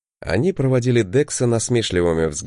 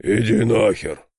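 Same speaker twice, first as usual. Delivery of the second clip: muffled from afar. A narrator's reading. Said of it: "глухое пожелание из темноты".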